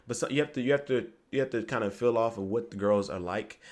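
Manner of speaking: ordinary delivery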